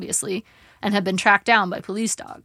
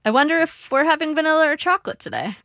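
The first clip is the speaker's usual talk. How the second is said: cheerful diversion; 9 semitones higher, 3 dB louder than usual. A narrator's reading. Narrator diverting father.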